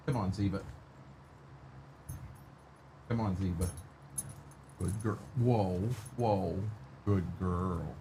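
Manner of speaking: low, hushed coaxing; soft dog coaxing. Real, not acted. Streamer coaxes his dog Zeba in the cab, hushed. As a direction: gently coax the dog over.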